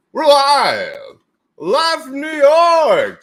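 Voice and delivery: loud, high, stretched hype call; hyped announcer shout. Acted. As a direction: announce it like a showman. Streamer hyping going live.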